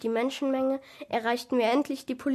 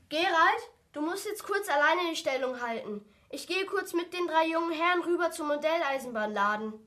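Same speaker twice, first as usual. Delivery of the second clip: raised pitch, calling across rooms; calling to a colleague; 6 semitones higher than usual. Acted. Policeman telling Gerald to hold the fort, calling out. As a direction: call out without shouting, higher voice.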